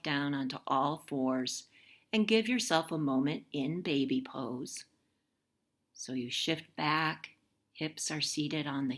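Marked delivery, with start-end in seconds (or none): none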